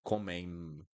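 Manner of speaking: comem with a full M at the end; wrong closed M. Shown as a demonstration. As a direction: shut the lips hard on the final M of comem. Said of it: "You don't need to do that".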